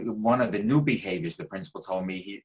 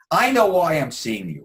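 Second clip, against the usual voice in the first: higher, louder retold yell; imitated yelling; 7 semitones higher, 8 dB louder than usual. Angry ten-year-old at his first therapy session, imitated yell. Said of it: "He comes in and yells".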